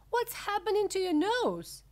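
high, loud alarmed question; mother's high-pitched alarm; shriek. A narrator's reading. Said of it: "shrieked Missus Beauregard suddenly".

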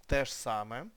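usual speaking voice

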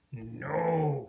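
lightly voiced frog; a hint of a frog's croak. A narrator's reading. Frog denying to Peep it sang, mild croak. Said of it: "croaked the frog".

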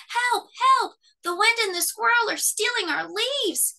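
high, loud frantic cry for help. A narrator's reading. Cub cries for help as leaves are stolen, frantic. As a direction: cry out in a panic. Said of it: "cried a frantic Fletcher".

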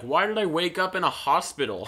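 lightly acted indignant question; mock bewildered star. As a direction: imitate an overdramatic actress waking confused. Mimicking star waking in hospital.

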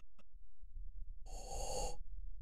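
startled gasp. Real, not acted. Sudden scare.

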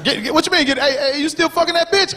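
high, disbelieving mock shout; stunned outrage at his boy; incredulous friend. Man quizzing his boy on the phone, high incredulous.

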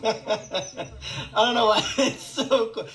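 talking through helpless laughter; real giggling fit. Real, not acted. Unable to explain why the bath mat amuses, giggling. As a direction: try to get words out between laughs.

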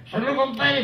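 high, tipsy toast; slurring a drunk toast; lightly drunk voice raising a glass to a friend; drunk buddy. Acted. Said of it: "la lógica está así ahorita".

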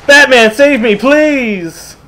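high pleading cry; mock plea. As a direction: beg for rescue, hammed up.

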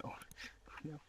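hushed whisper of regret; barely audible oh no; whispered dread. Real, not acted. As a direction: whisper it to yourself, horrified. Quiet dread after a mistake.

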